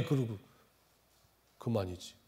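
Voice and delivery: ordinary talk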